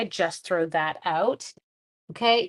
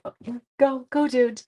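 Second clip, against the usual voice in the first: higher-pitched urging, lightly acted; retold hasty permission; 4 semitones higher than usual. Teacher letting a gassy student step outside, mock urging. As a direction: wave them out with a quick go-ahead.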